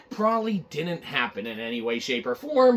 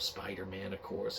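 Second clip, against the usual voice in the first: ordinary talk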